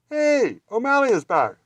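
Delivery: loud, high cheerful shout; a friend thrilled to see O'Malley back; excited greeting. A narrator's reading. Party cat greeting returning O'Malley, loud happy shout. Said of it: "cried Scat Cat".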